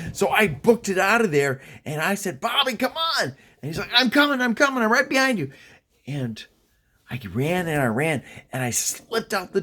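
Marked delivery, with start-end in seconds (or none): 2.4-3.2 high-pitched hurry-up call; urgent call. Retold or imitated. Fleeing kid calling to his lagging friend, urgent. 3.9-5.5 high, urgent reply acted out; hurried reply. Bobby answering as he runs for the door, hurried. Friend answers while fleeing.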